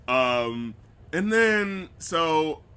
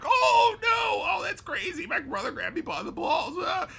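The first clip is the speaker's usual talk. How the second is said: imitating a hammy actor, much higher, shouting then crying; mock overacted freakout. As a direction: ham up a fake-shocked wail at the top of the voice.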